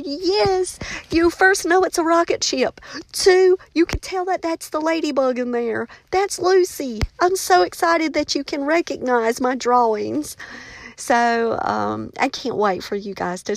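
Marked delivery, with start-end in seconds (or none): 0.0-10.3 higher, bubbly praise; gushing back at the student with pride; excited reply. Retold or imitated. Teacher answering her student, higher and thrilled. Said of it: "And I was like".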